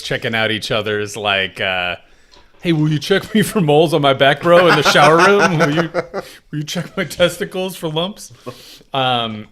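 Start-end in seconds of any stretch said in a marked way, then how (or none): 2.6-8.3 raspy, loud jock bro. Retold or imitated. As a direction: ham up a gym bro. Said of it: "The way you said that, made it sound like bros".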